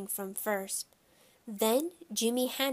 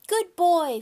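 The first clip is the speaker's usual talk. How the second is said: put-on high, loud toddler voice; high-pitched childish echo; little boy Fudgy; 8 semitones higher, 8 dB louder than usual. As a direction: chirp it like a tiny child. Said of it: "Fudgy said".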